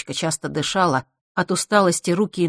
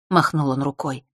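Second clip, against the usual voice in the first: ordinary delivery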